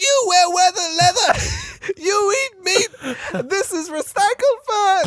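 very high, slow celebrity impression; Joan Rivers. Comedian scolding her fur interviewer, slow high Joan Rivers impression. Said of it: "No, she was like".

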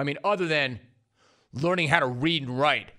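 normal talking voice

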